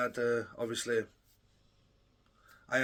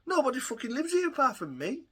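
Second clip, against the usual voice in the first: sudden much higher, baffled what; disbelief; 11 semitones higher than usual. Retold or imitated. Told of domestic violence charges, stunned and much higher. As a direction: squeak out the shock, then protest. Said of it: "And I'm like".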